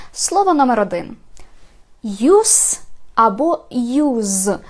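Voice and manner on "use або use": "Use" first ending in a hissed s, then "use" ending in a buzzing z; two pronunciations contrasted. Shown as a demonstration. Teacher showing two variants of one word, pronunciation contrast. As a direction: say "use" both ways, drawn out, s then z. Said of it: "прочитати це слово двома варіантами".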